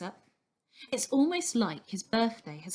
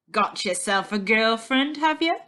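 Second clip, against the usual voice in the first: louder mocking growl of a fair cook; 2 semitones higher, 7 dB louder than usual. A narrator's reading. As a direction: tease him roughly, voice pushed out. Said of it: "he growls".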